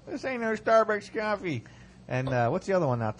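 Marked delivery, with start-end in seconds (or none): 0.1-1.6 high mocking declaration; mock tough singsong. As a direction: belt it out high as a joke. Playful sung echo.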